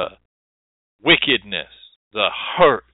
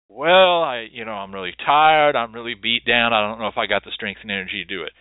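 tired client; 7 semitones lower, 4 dB quieter than usual. Retold or imitated. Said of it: "What do they say?".

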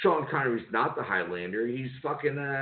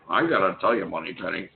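Sean Connery impression; 6 semitones lower than usual. Bond line after Connery talk.

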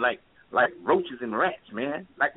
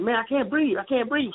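higher strained plea, like someone losing air; imitating a breathless plea; 8 semitones higher than usual. Shot kid telling the officer he can't breathe, higher plea. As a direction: pitch it up and plead. Retells dying kid's plea again.

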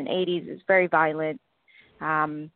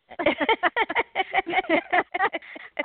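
high laugh; 11 semitones higher than usual. Real, not acted. Laughing at her own website.

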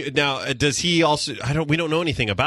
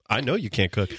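normal talking voice

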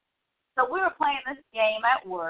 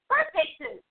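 confident; 8 semitones higher than usual. Retold or imitated. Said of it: "And I said it with confidence".

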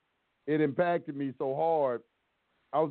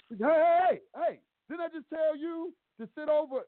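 judge hollering; 12 semitones higher than usual. Retold or imitated. Judge yelling at a man who walked off. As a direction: bark it like a judge losing his temper. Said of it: "Man, how he said it to me".

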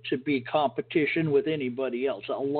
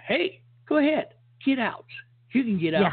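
mock permission to spouse; 9 semitones higher than usual. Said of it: "tell them".

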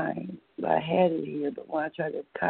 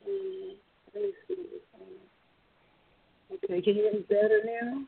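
tinny phone line sound; talking through a tin can; 7 semitones higher than usual. Real, not acted. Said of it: "You, you sound like you're talking through a tin can phone".

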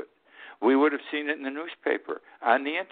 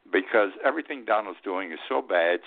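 normal talking voice

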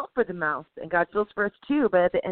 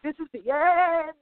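mock doom; 10 semitones higher than usual. Mock panic over scorpion stings.

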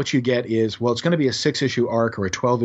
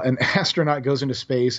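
ordinary talk, nothing marked

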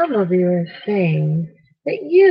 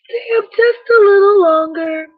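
playful; 9 semitones higher, 6 dB louder than usual. Acted. Teasing kids about staying up.

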